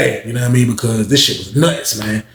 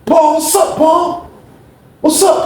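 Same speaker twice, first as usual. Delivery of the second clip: much higher, eager voice for bro; mock eager greeting; 3 dB louder, much slower than usual. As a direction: call out to Paul high and keen.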